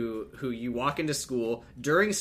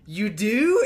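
high, loud mock-hopeful question; eager surprise; 8 semitones higher, 7 dB louder than usual. Ben hears Amy love him back, mock surprise. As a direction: imitate an overjoyed double take.

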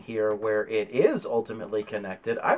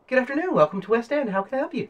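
much higher, quick receptionist greeting; young lady receptionist; 14 semitones higher, 4 dB louder, faster than usual. Acted. Receptionist welcomes a visitor to West End, high-voiced and pleasant. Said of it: "she kind of looks back at you and says".

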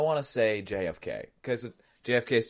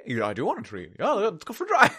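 eager dog voice; 8 semitones higher, 5 dB louder than usual. Pretend.

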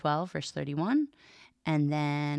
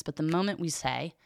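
ordinary delivery

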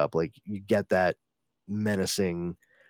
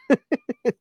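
high laugh; 14 semitones higher, 4 dB louder than usual. Real, not acted. Laughing at villain's grudge.

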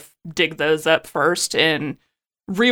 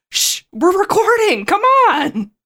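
hushing; 12 semitones higher, 5 dB louder, slower than usual. Pretend. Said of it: "you'd have to be like".

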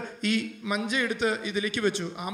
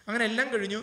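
ordinary talk

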